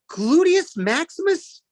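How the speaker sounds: high, slow, disbelieving teacher reading the name; teacher stunned by a silly name on the list; baffled teacher. Retold or imitated. Fifth grade teacher reading roll, high and incredulous. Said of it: "my fifth grade teacher is calling roll call".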